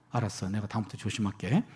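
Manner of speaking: low, soft retold promise; conceding softly. Dad yields to his arguing little daughter, soft low concession. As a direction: give in quietly, voice dropped.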